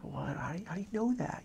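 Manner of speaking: hushed, high, rushed disbelief; incredulous companion. Retold or imitated. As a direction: imitate a baffled companion, voice climbing quickly. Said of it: "You know, kinda like that look".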